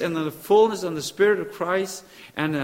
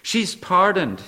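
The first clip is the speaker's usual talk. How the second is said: usual speaking voice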